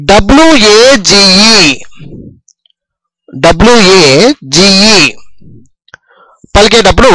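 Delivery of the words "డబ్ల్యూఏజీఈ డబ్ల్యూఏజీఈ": letter by letter, very slow, like dictating to a class; careful slow dictation of the letters; slow spelling. Shown as a demonstration. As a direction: slowly spell out each letter of డబ్ల్యూఏజీఈ. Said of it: "ఇక్కడ జాగ్రత్తగా చూడండి".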